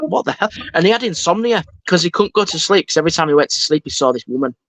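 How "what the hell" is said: high, startled what the hell; stunned disbelief, pitched up; baffled. Retold or imitated. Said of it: "And I was like".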